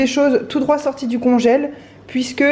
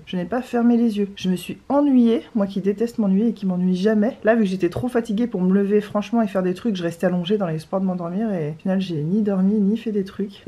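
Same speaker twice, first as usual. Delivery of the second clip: ordinary talk, nothing marked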